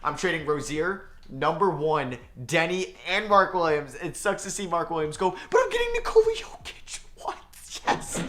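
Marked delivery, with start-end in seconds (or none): 5.5-8.2 a giddy burst of pitch; excited squeal. Real, not acted. Gamer landing Jokic in a trade, excited squeal. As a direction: squeal it, much higher.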